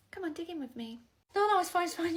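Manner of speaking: ordinary talk, nothing marked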